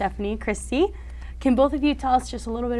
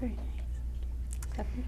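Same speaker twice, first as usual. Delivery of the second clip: soft breathy whisper, slow; whispered aside; 3 semitones higher, 8 dB quieter, slower than usual. Real, not acted. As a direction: whisper it so the mic barely catches it. Host murmurs reply off-mic.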